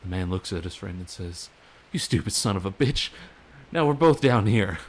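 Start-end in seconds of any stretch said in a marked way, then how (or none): 1.9-4.8 loud, rising angry outburst; indignant storyteller's shout; exasperated scolding. A narrator's reading. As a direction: berate a foolish friend loudly. Man berates friend in told story.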